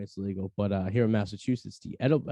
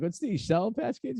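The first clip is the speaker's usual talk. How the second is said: innocent question; 9 semitones higher, slower than usual. Retold or imitated. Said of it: "and I'm like".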